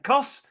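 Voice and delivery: Cuss said as one clipped syllable, high; careful Scottish Cuss. Shown as a demonstration. Explaining to his co-host how Cuss sounds. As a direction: spell out the word Cuss in his own accent. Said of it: "how else can you say it?".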